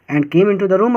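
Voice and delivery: ordinary talk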